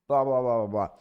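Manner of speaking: loud, hammed-up blah blah; mock filler blather. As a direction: rattle it off loudly like filler.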